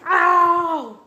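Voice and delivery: loud, high, drawn-out ow; hammed-up howl of pain; acted pained yelp. Danzo losing blood in the fight, mock howl of pain. Hurt character in retold fight.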